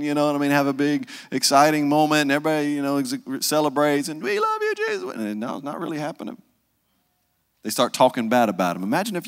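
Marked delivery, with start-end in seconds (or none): 4.2-5.1 much higher, gushing fan cry; adoring fans. Retold or imitated. Crowd adoring Jesus imagined by preacher, high gushing squeal.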